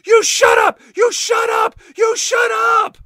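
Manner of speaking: high, full-force screamed command; hammed-up shrieking tantrum; shouting at full voice. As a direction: scream it at the top of your voice. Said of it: "she's just shouting at the top of her voice".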